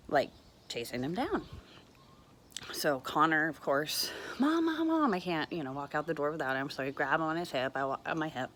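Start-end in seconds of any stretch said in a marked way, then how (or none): 4.4-5.1 high, urgent little voice calling Mom; voicing the toddler's urgent cry; child calling mom. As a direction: call out like a scared toddler.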